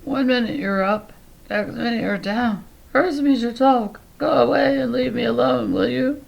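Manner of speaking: lion pretending to be an ailing woman; disguised sick lady. A narrator's reading. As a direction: shoo them off in a feeble disguise. Leo keeps up disguised voice.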